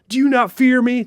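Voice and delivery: high, loud demand; a god thundering at a defiant soul; threatening god. Acted. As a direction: bellow it like a deity demanding fear.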